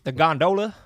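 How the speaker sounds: "gondola" said gon-DO-la; long-O gondola. Real, not acted. Answering the friend's quiz, "gondola" as gon-DO-la. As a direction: give "gondola" a long DOE in the middle. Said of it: "how do you pronounce that".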